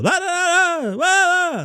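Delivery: very high, frustrated mock blahs; angry Jerry Seinfeld. Shown as a demonstration. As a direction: squawk the blahs high like an exasperated comic. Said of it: "angry Jerry Seinfeld".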